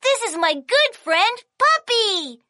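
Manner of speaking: ordinary delivery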